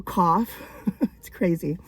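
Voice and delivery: usual speaking voice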